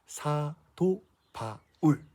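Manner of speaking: put-on very slow, spelled-out name for kids to echo; slowly drawn-out teacher prompt; slow call to repeat. Shown as a demonstration. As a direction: draw each syllable out to be repeated. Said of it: "따라해볼까요?".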